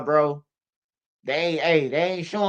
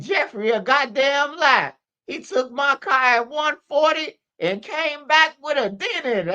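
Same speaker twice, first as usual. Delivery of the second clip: imitated indignant mother, pitched up; indignant mama; 8 semitones higher than usual. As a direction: play the outraged mom snapping back. Mama denying to police, imitated.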